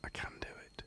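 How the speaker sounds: very soft, voiceless whisper; whispered proof of being quiet. Shown as a demonstration. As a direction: drop right down to a whisper. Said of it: "quiet is, is not a default".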